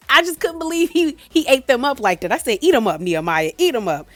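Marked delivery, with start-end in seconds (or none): 0.1-2.3 high, animated, laughing start; amused disbelief. Real, not acted. Vlogger reacting to the show, amused high disbelief. 2.6-4.1 loud, punchy cheer; egging him on. Retold or imitated. Viewer cheering on Nehemiah, loud egging on.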